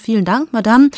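high, affected "Madame", hammed-up courtesy; mock gallant thanks. André thanks Vera theatrically, hammed-up gallantry. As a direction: play the smarmy young actor.

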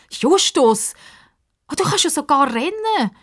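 high-pitched amazed exclamation; astonished. A narrator's reading. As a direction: exclaim in wonder. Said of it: "sagt Pippa erstaunt".

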